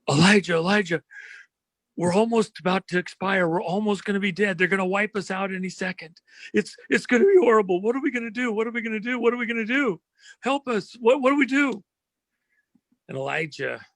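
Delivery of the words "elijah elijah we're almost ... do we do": high, frantic, terrified pleading; a servant sure the enemy will kill them any second; much higher, breathless panic, hammed-up; panicked servant. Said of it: "he comes in and he's".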